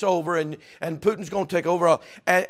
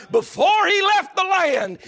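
shouted; 13 semitones higher, 7 dB louder, slower than usual. Acted. Shouted repeat of phrase.

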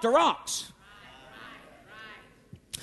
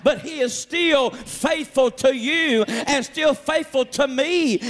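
ordinary delivery

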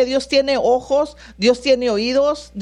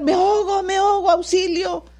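drowning cry for help; 7 semitones higher than usual. Acted. Preacher acting out someone drowning, high cry for help. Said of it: "hasta gritan".

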